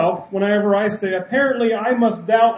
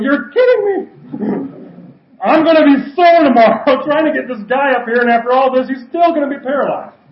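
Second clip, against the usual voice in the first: much higher and louder, laughing in disbelief; exasperated roof helpers; 5 semitones higher, 7 dB louder than usual. Retold or imitated. Friends on the roof complain to the preacher, exasperated and laughing. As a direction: groan it out with a laugh, sore and fed up. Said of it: "The four guys on top of the roof are going".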